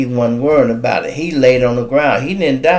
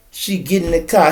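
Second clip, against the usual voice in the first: usual speaking voice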